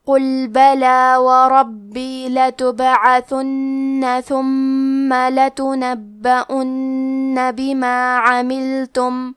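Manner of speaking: slow, drawn-out chanted tilawat; Quran recitation. Acted. Teacher recites the verses in tajweed recitation style.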